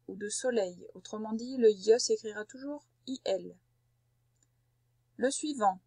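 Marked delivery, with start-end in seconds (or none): none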